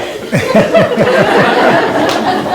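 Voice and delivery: loud breathy laugh. Real, not acted. Laughing about Ida refusing the nurses, breathy. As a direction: let the laugh out.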